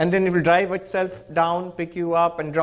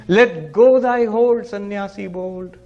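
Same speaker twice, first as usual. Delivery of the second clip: slow declaimed verse; 2 semitones higher, 4 dB louder, slower than usual. Acted. Reciting the poem's solution.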